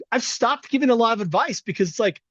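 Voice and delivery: ordinary talk, nothing marked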